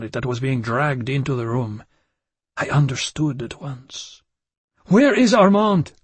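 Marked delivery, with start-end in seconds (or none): 4.8-5.9 higher, louder desperate cry. Acted. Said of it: "I said desperately".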